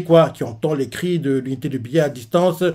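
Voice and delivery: ordinary talk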